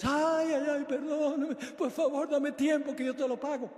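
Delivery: high, wailing beggar's plea; whiny, pretend-weeping appeal for time; mock tearful pleading. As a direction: beg like a hammed-up crybaby. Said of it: "forma la llorona".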